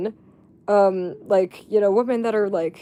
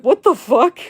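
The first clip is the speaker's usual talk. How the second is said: put-on a louder, stunned boyfriend; mock boyfriend reaction; 5 semitones higher, 7 dB louder than usual. Imitating the boyfriend reacting to the accusation, louder. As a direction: bark it like the confused boyfriend. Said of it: "he's like".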